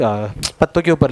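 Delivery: normal talking voice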